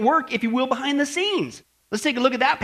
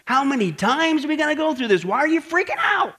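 mock exasperation; 4 semitones higher than usual. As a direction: call them out as if nobody's home. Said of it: "Let me give you the Crone translation".

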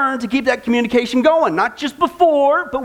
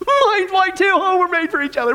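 high, gushing mock sweetheart voice; a hint of a giddy new girlfriend, pitched up; lovestruck girlfriend; 8 semitones higher than usual. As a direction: ham up a smitten girl over nothing. Voicing a lovestruck partner's chat.

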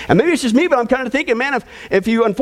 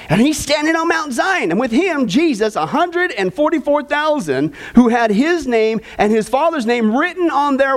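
lifted, measured Bible reading to the congregation; scripture reading; 4 semitones higher than usual. Acted. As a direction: read the verses out like a preacher at the pulpit. Reads scripture aloud.

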